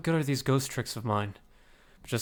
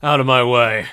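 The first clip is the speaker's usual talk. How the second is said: loud barked order; angry shout; 11 dB louder, slower than usual. Acted. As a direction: shove people aside with your voice. Character shoving past in game scene.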